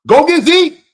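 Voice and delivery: high, loud burst for Zeke; pumped-up cheer for Zeke; excited shout. Real, not acted. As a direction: shout it like a fan hyped on a pick. Hyped about Zeke pick.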